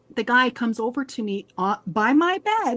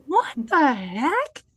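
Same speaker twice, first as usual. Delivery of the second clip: slow baffled what the heck; 4 semitones higher, much slower than usual. Retold or imitated. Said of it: "And I was like".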